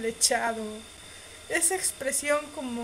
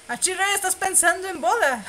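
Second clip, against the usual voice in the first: teasing exclamation; 8 semitones higher, 7 dB louder than usual. Real, not acted. Teasing reaction to the story.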